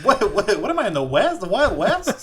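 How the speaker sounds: high, loud, laughing protest; laughing mock disbelief. Real, not acted. Teasing a friend's impression, loud laughing disbelief.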